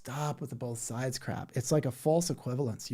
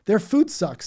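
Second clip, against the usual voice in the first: mock false equivalence; 7 semitones higher, 6 dB louder than usual. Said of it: "it's like saying".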